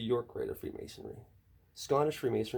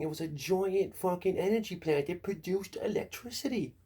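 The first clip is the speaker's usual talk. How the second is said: mock believer; 6 semitones higher than usual. Mocking conspiracy believers.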